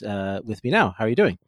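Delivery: usual speaking voice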